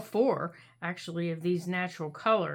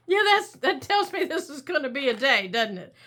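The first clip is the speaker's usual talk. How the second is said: louder, giggling through words; laughing; 4 semitones higher, 4 dB louder than usual. Real, not acted. Laughing fit.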